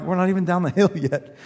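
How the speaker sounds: laughing through the words; half-laughing disbelief; laughing quote. Retold or imitated. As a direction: giggle it out. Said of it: "I'm like".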